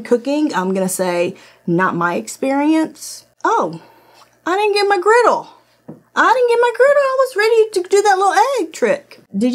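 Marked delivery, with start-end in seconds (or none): 4.4-9.2 high-pitched fake-crying complaint; mock whining. Complaining to viewers about the missing griddle, mock whine. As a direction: wail it like a let-down kid, much higher.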